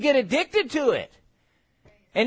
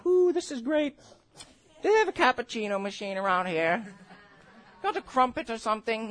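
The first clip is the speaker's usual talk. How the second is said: mock relaxed mother; 4 dB quieter than usual. Woman in labor mocked as relaxed and breezy. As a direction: play it like a spa visit. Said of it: "women in there going".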